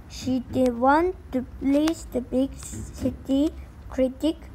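ordinary delivery, nothing marked

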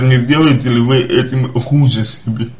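usual speaking voice

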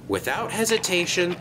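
ordinary delivery

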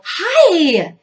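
a high, friendly hi; cheery hi. Retold or imitated. Greeting people in the hallway, a cheery high hi. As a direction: toss out a bright hi. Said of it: "or I'd go".

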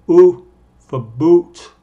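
U drawn out slowly and loud before for boot; slow emphasized vowel. Shown as a demonstration. Teacher reviewing the u vowel, slow and loud. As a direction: stretch the U. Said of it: "con énfasis en el vocal".

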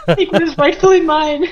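giggling through an indignant claim; laughing mock protest. The box claiming the box, laughing mock protest. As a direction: pretend outrage while laughing.

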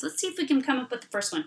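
usual speaking voice

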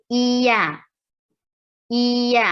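"เอีย" held long, gliding from ee to a; vowel demonstration. Shown as a demonstration. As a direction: draw out "เอีย" on a steady pitch for repetition. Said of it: "เรียนออกเสียงเอีย".

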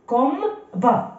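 slow "كوم", then "با" as its own syllable. Shown as a demonstration. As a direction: sound out "كوم با" slowly, final T silent. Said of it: "دونك كوم". (قراءة "كوم با" ببطء مقطع بمقطع)

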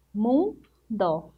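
"Mundo" as two parts, mun, pause, do; split into syllables. Shown as a demonstration. Teacher counting two syllables for pupils, "Mundo" split apart. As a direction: break "Mundo" after "Mun" with a clear gap. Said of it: "Duas sílabas".

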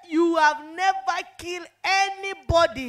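loud, taunting yell of the accusing demon; mock demon shout. Demon jeering at the dying woman, loud taunting shout.

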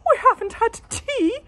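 very high, loud, giddy voice; excited laugh. A narrator's reading. Wife thrilled about tea, high and giddy. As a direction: gush with delight. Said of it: "she laughed".

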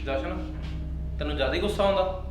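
ordinary talk, nothing marked

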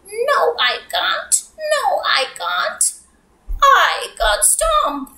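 little fish piping high; high squeaky fish voice. Pretend.

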